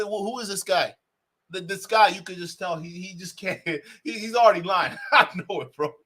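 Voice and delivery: usual speaking voice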